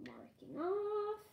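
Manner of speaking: normal talking voice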